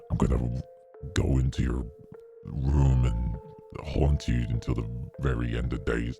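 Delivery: low, sinister demon threat. Acted. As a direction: menace them like a haunting demon. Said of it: "'cause I'm meant to be a horror V tuber".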